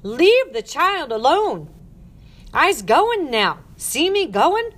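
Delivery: slow, high, ringing shout; very loud protest. A narrator's reading. Ruth defending the child from the matron, very loud. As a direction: shout it with every word drawn out. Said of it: "in as loud a voice as".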